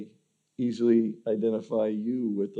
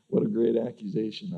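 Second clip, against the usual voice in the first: ordinary talk, nothing marked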